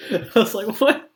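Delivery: high, loud laugh breaking into words; laughing hard. Retold or imitated. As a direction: crack up while retelling the reaction. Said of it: "I was laughing so hard".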